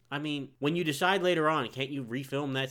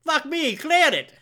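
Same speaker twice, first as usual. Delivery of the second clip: excited ad lib shout; 7 dB louder than usual. Retold or imitated. Quoting movie ad lib loudly.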